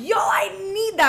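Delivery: high, loud excited yell; shrieking yo I need that at friends; excited shout. Retold or imitated. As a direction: shout it like you need it right now. Excited about cup condom.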